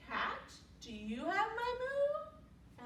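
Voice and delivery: high, drawn-out pleading question; cow asking. A narrator's reading. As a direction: call out sweetly high, stretching the words. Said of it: "she asked the cat".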